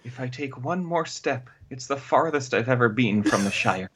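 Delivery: a softly begun, low movie-quote impression; Sean Astin. Podcast host quoting Sam on leaving the Shire, lightly imitated. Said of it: "that was Sean Astin".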